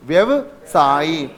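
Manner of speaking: व्यवसायी spoken very slowly, each syllable drawn out; slow clear Hindi word. Shown as a demonstration. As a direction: spell out व्यवसायी slowly for the class. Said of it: "Hindi में इसे बोलते हैं". (व्यवसायी को धीरे-धीरे साफ़ बोलिए)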